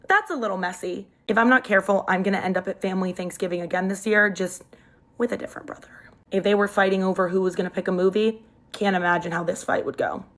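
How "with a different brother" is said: whispered aside. Real, not acted. Confiding to the viewers, whispered. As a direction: lean in and whisper it.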